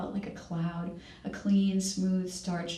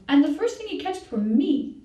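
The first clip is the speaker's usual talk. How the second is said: high, loud, gleeful shout; crying out; 9 semitones higher, 8 dB louder than usual. A narrator's reading. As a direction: call it out proudly over the others. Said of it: "the littlest cried out".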